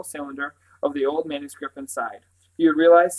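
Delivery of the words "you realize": emphatic, grave opening. A narrator's reading. Brother points out the lucky hiding place, grave. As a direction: make them take this seriously. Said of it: "Simon said gravely".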